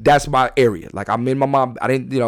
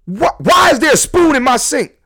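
mock angry mom; 11 semitones higher, 4 dB louder than usual. Angry mom voiced in story.

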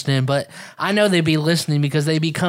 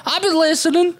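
sheepish friend; 10 semitones higher than usual. Retold or imitated. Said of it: "They're like".

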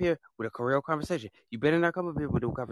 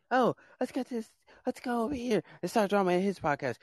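much higher, put-on voice mimicking trolls; mocking troll voice; 9 semitones higher than usual. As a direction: ham up the gleeful troublemakers. Mocking trolls who start drama.

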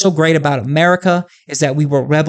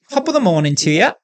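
higher-pitched British accent drifting into Australian; British accent attempt; 4 semitones higher than usual. Shown as a demonstration. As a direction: have a shaky go at a British greeting accent. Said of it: "still going Australian".

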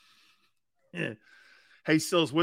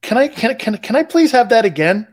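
loud, higher, stumbling request; raised voice, restarting the question; calling out; 6 semitones higher, 12 dB louder than usual. Real, not acted. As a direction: call out to a voice assistant for a repeat. Calling out to the voice assistant.

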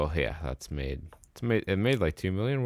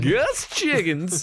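put-on old prospector; 11 semitones higher, 5 dB louder, much slower than usual. Mock old-timer name.

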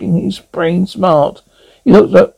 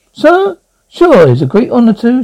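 chirpy computer voice; 6 semitones higher than usual. A narrator's reading. Computer gushing.

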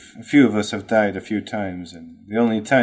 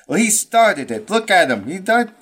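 high, whiny, defensive; whiny kid voice; 9 semitones higher than usual. Acted. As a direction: protest like a sulky child. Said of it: "Damon, uh, says".

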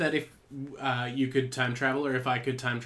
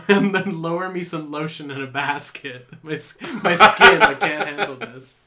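laughing; 3 semitones higher, 10 dB louder than usual. Pretend. Acted Silence of the Lambs plea.

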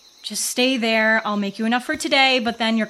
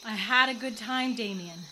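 tired; 9 dB quieter than usual. Real, not acted. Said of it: "I'm just tired".

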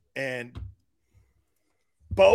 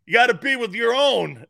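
mock grandfather; 5 semitones higher, 7 dB louder than usual. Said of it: "My grandfather goes".

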